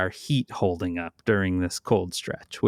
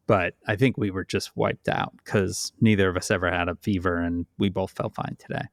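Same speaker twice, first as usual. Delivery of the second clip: usual speaking voice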